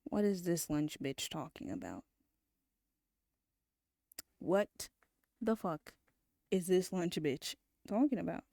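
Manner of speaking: hushed, half-whispered disbelief; baffled muttering. Real, not acted. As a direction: say it under your breath, utterly lost. Baffled muttering at the game character.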